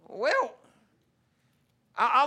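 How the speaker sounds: usual speaking voice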